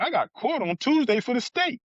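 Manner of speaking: indignant protest. Retold or imitated. Protesting transfer to feds.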